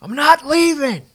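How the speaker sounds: high, loud, slow broadcast call; triumphant call. Retold or imitated. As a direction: announce it like a winning moment. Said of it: "And I had that call".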